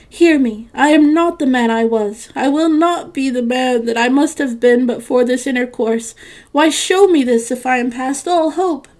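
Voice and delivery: loud, urgent pleading; desperate plea. A narrator's reading. Scrooge clutching at the spirit's robe, desperate plea. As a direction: plead at full voice. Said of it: "tight clutching at its robe".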